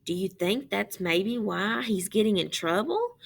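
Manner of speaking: usual speaking voice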